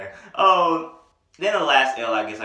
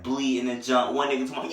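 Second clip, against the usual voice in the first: ordinary talk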